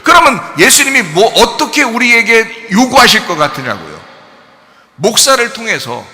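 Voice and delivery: shouted, high, fervent sermon; full-force preaching. Acted. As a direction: preach it at the top of your voice.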